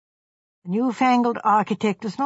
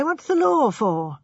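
the same line higher and slower, building outrage; rising indignation; 5 semitones higher, slower than usual. A narrator's reading. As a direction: repeat it with hammed-up righteous anger, pitched up. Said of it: "He assumed virtuous indignation".

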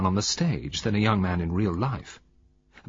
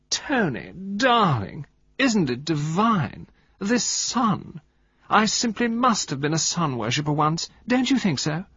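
high, affected drawl, swooping on key words; raised high voice; 8 semitones higher, slower than usual. A narrator's reading. Wife showing off to her husband, raised high voice. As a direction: gush languidly in a high-pitched voice. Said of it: "her voice was raised a little higher".

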